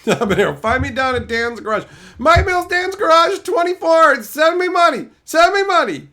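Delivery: high, loud yelling impression of a streamer begging for cash; mock yell. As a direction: belt it out like a hyped guy screaming for money. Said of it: "having him yell".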